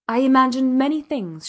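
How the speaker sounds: measured, pointed statement; slowly. A narrator's reading. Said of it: "she said slowly".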